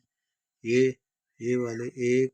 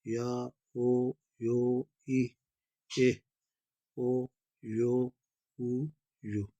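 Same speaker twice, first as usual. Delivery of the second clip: long, separate vowels with gaps between; alphabet recitation; slower than usual. Shown as a demonstration. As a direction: read them out like an ABC, slowly, one by one. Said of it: "تو اس کو پڑھیں گے".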